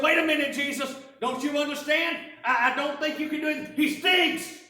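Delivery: loud, high objection; shouting in protest at Jesus; alarmed protest. Acted. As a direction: stop him before it's too late. Disciple protests rolling away stone.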